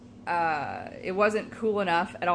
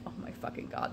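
whispering; 11 dB quieter than usual. Retold or imitated. Exasperated aside about the island.

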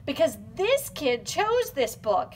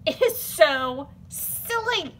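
ordinary delivery

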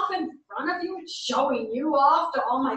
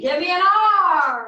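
cheer call; 4 semitones higher, 8 dB louder than usual. Acted.

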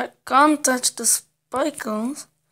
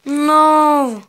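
loud, higher protest; frustrated no; 4 semitones higher, 7 dB louder than usual. Real, not acted. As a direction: cry out no in frustration. Gets sent back in the game.

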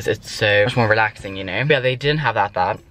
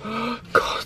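gasp; 10 semitones higher, 6 dB quieter than usual. Real, not acted. Shocked gasp.

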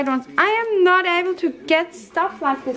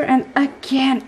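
usual speaking voice

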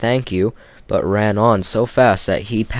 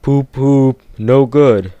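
louder grumbling engine, a touch higher; grumbling Gordon; 4 semitones higher, 6 dB louder, slower than usual. Acted. Gordon doubting Edward's help, grumbling engine voice. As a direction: grumble like a gloomy big engine. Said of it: "grumbled Gordon".

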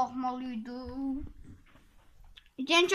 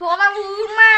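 higher, loud protest; shouting; 6 semitones higher, 11 dB louder than usual. Real, not acted. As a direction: yell to stop the attack. Being hit in game.

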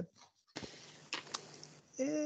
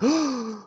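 sharp acted gasp; one audible intake of breath; crowd gasp; 12 semitones higher than usual. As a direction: gasp in mock shock. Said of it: "everyone kinda gasps".

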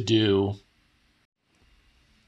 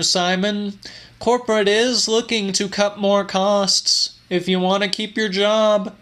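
a lifted, higher voice for the scolding boss; higher-pitched voice of a patronizing manager; smug boss; 11 semitones higher than usual. A narrator's reading. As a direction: play the condescending supervisor, pitched up. Narrator voices boss Jerry.

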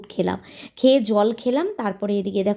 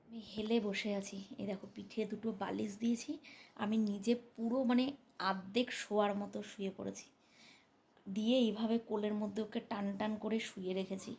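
hushed talking; 9 dB quieter than usual. Real, not acted. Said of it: "আস্তে আস্তে কথা বলছি".

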